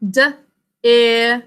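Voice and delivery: ordinary delivery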